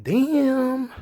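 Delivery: high, drawn-out amazed damn; a fan floored by the promo; stunned damn. Retold or imitated. Fan watching Owens reacting with an amazed high damn.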